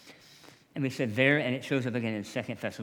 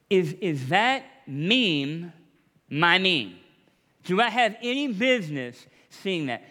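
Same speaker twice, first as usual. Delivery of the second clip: emphatic preaching; 5 semitones higher, 7 dB louder than usual. Real, not acted. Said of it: "rising preaching emphasis".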